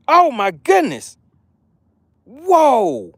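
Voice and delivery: stunned, high and drawn out; surprised. Real, not acted. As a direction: gasp at the shock. Reacting to the upset.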